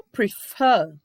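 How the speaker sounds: "prefer" slowly, with "fer" loud and long; stress on final syllable. Shown as a demonstration. Teacher introducing an oxytone verb, strong stress on fer. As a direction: punch the second syllable of "prefer". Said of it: "A sílaba mais forte dele é o fer".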